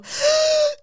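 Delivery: loud, sharp mock gasp; kids' horrified gasp. Kids seeing the creature at the door, horrified gasp. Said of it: "And they go".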